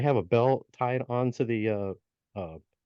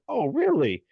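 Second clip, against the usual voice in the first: high-pitched, acted interest; intrigued surprise; 8 semitones higher than usual. Brother hearing the gnome's offer, intrigued surprise. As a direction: perk up at the offer.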